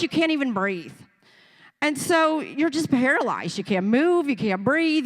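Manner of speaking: ordinary talk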